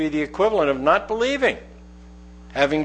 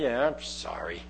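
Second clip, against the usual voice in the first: much lower, much softer grudging yeah I'm sorry; grudging apology; 10 semitones lower, 10 dB quieter than usual. Shown as a demonstration. Preacher imitating kids who don't mean their sorry. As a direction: mutter it like a sulky kid forced to apologise. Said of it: "Kids do that all the time".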